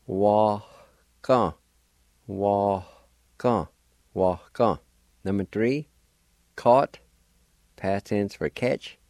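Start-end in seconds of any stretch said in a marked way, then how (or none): 0.1-4.8 "Wa, ka" with a faint H between the syllables; faint H in the middle. Shown as a demonstration. Teacher drilling the faint H in "Wa, ka". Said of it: "faint H sound, like this".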